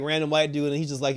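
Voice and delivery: ordinary talk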